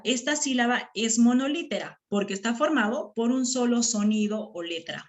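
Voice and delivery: usual speaking voice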